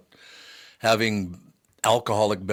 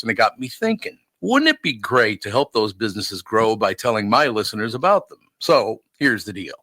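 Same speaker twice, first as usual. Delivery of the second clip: upbeat sponsor read, a touch louder; 5 dB louder than usual. Acted. Host pitching businesses in an upbeat ad read. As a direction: sell the listeners on the partner program.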